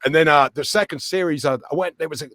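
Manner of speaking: usual speaking voice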